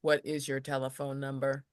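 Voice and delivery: low, monotone robot question. Shown as a demonstration. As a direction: say it evenly like a robot, without inflection. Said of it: "One sounds like a robot".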